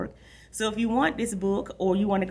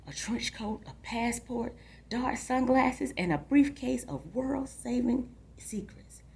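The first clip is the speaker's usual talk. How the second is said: secret spy whisper; 6 semitones higher, 5 dB quieter, slower than usual. Acted. Kid daydreams spy fantasy.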